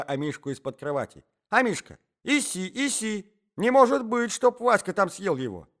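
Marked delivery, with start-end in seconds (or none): 1.5-5.7 put-on high, loud old man's cries; agitated calling. A narrator's reading. Old man fears Васька ate the dog, agitated calling. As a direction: call the dog, then exclaim in disbelief. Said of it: "кричал старичок".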